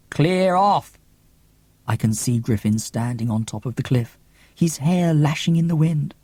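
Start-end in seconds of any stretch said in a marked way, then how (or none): 0.1-1.0 mild shout. A narrator's reading. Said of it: "Griffin shouts".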